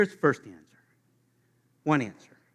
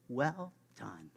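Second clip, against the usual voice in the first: hushed; 4 semitones lower, 9 dB quieter than usual. Pretend. God's welcome, hushed.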